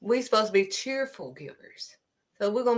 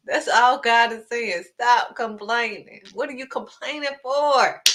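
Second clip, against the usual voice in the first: ordinary talk, nothing marked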